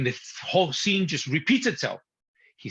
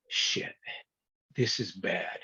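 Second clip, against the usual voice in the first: quiet and lower, a sinking realization; low dismayed aside; 7 semitones lower, 7 dB quieter, slower than usual. Retold or imitated. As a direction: drop the voice to a hushed, dismayed low. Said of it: "I would have said".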